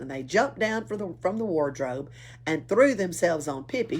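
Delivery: ordinary delivery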